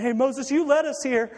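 imitating boo-hoo grumblers; mock whining. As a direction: whine it like a sulky crowd blaming the leader. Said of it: "You know, boo-hoo".

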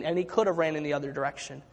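usual speaking voice

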